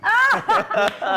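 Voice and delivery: bright burst of laughter; loud laugh. Real, not acted. Host laughs at the guest's joke, loud laugh.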